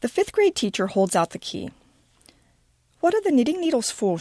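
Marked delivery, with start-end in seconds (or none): 3.0-4.2 put-on high, pointed English accent; barbed English accent. A narrator's reading. English teacher asking Miranda about knitting needles, high barbed English accent. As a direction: probe with a high English barb. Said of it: "uses her accent to barb everything she says".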